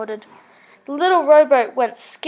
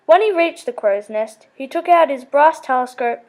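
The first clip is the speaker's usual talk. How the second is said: usual speaking voice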